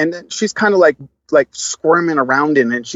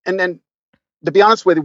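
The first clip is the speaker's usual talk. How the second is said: ordinary talk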